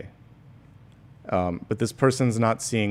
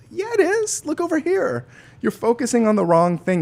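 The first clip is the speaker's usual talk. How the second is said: very high, airy voice of the universe; the universe answering; 12 semitones higher than usual. Retold or imitated. The universe answering the doubting voice, very high and airy. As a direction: sing it down from above, light and high. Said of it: "the universe is like".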